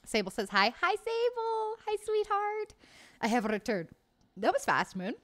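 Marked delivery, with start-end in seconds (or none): 0.8-2.7 cooing hello. Real, not acted. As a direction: coo at a pet. Cooing greeting to a pet.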